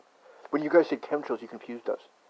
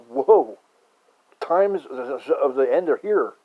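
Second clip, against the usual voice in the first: mock doom prophet; 2 semitones higher, 6 dB louder, much slower than usual. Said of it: "I'm like".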